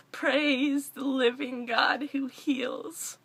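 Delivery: very slow, declaring it; a solemn proclamation; proclaiming praise. Real, not acted. Closing the message to supporters, proclaiming slowly.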